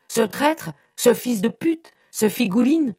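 volley of high, loud insults; furious howling. A narrator's reading. Brother beside himself, insulting the fiancé, furious howling. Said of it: "hurla-t-il".

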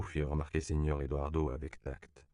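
usual speaking voice

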